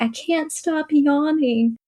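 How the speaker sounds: high, slow little-girl voice, imitating her younger self; drawn out like a small child explaining; childlike voice. Retelling what she told her teacher, in a high slow child voice.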